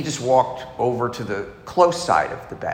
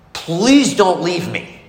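slow, higher plea of "Please don't leave me"; beg, stretching out "Please"; a grown man's childlike plea of "Please don't leave me"; pleading; 6 semitones higher, 4 dB louder, slower than usual. Retold or imitated. His fear of being left.

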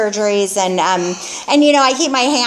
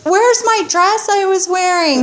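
imitating her past self, higher and urgent about the dress; frantic vain self; 9 semitones higher than usual. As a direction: play the drunk, vain girl asking for her dress. Retelling her hospital self.

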